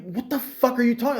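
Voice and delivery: exasperated at the manager's non-answer; baffled exasperation. Retold or imitated. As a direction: disbelief at the nonsense. Retold baffled outburst at manager.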